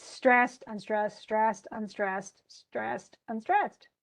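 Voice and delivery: slow beat, "stressed" leaned on and "unstressed" lighter; meter demonstration. Shown as a demonstration. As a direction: tap out stressed, unstressed in steady alternation. Chanting the stress pattern.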